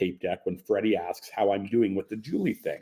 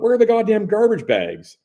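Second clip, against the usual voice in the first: loud, higher shout across the hotel room; annoyed yell; 12 semitones higher, 8 dB louder than usual. Retold or imitated. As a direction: yell it out in frustration. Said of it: "So I yell out".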